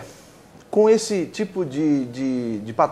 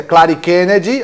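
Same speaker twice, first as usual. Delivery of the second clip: angry mother calling; 7 dB louder, slower than usual. Retold or imitated. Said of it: "quando ela tava brava comigo".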